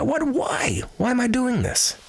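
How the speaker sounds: high, incredulous why; bewildered cry. Real, not acted. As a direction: squeak out your own baffled question. Bewildered at his own urge.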